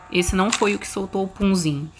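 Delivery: normal talking voice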